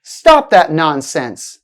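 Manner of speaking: slow command with "nonsense" falling at the end; falling intonation. Shown as a demonstration. Teacher models a command for learners to repeat, falling intonation. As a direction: start firm on "Stop", let "nonsense" drop. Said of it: "sounds like a fall in the pitch".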